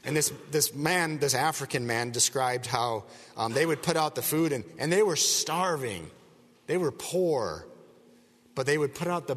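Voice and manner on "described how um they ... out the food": ordinary talk, nothing marked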